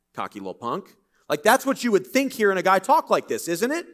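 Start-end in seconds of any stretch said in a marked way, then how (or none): 0.1-0.9 a laugh, then a dry scoff. Acted. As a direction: chuckle it off dismissively. Mocks perceived arrogance.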